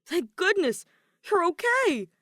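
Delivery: high, loud, relieved outburst; relieved exclamation. Acted. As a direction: gush with relief. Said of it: "exclaimed in relief".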